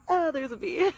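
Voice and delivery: high startled yelp at a bee; sudden alarmed squeal. Real, not acted.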